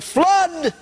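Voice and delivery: high loud cry of flood; high shout; yelled warning. Acted. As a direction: shout it to scatter the squad. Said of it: "He said".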